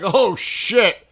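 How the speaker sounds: loud, high oh shit of sudden fright; alarmed curse. Retold or imitated. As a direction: shout it as the truck bears down. Retold moment of near crash.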